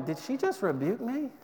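softly puzzled, rising question; quietly acted bewilderment; mock disbelief. Acted reaction to a sweet rebuke.

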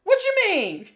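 high, loud mock "Huh?" of confusion; puzzled stranger. Detroit stranger puzzled by literal question. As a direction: play a baffled passerby.

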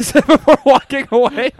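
can't hold the laugh in. Real, not acted. Narrator cracking up reading his own story, laughing.

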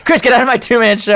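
high-pitched pretend indignation; hammed-up, joking outburst; mock outrage. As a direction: play-act kicking a friend out. Mock outburst at second friend.

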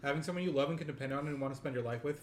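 ordinary talk, nothing marked

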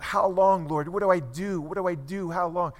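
acted-out anguished prayer; crying out. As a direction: cry it out desperately, easing toward the end. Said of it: "He's there crying out".

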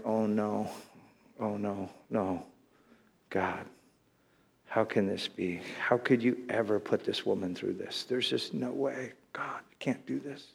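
low, soft retold prayer fading to a whisper; hushed dismay. Pastor asks God how this can be, hushed dismay. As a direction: plead quietly with God, sinking into a whisper. Retells his despairing private prayer.

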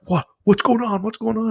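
high, eager questions from the dinner table; curious friends. Retold or imitated. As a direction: mimic everyone crowding round asking. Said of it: "everybody's like".

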